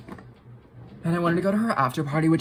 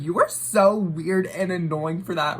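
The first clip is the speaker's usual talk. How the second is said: higher, louder, slow irritated retort; annoyed retort; 4 semitones higher, 5 dB louder, slower than usual. Retold or imitated. Telling off the girl filming behind her, annoyed and loud.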